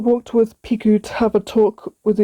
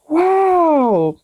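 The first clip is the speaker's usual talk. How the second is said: amazed; 7 semitones higher than usual. A narrator's reading. Amazed at beautiful cave.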